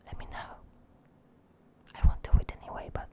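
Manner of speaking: whispering. Acted. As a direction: breathe the goodbye in a whisper. Whispered sign-off, ASMR tease.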